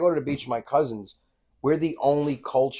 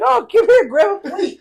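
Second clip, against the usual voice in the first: much higher, louder mom voice, calling out; Italian mom inviting; 10 dB louder than usual. Retold or imitated. Mother calling beachgoers over for pasta, high and loud. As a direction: wave strangers over like a hostess mom.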